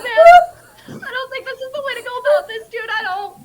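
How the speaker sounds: loud tearful plea; crying. Acted. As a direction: sob through the plea. Lilith crying, laughing.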